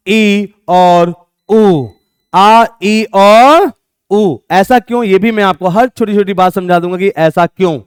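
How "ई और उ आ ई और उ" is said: drawn-out vowels. Shown as a demonstration. Teacher stresses the three vowels.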